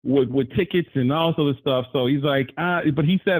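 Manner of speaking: ordinary talk, nothing marked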